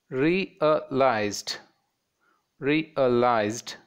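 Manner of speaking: "realized" split as re-a-lized; three distinct beats on "realized"; three separate syllables. Shown as a demonstration. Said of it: "it's three syllables".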